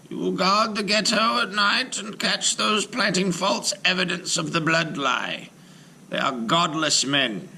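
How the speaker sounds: high, firm voice of a stern rabbi. Acted. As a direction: give the giant his orders one by one. Rabbi instructs golem.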